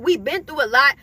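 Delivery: ordinary talk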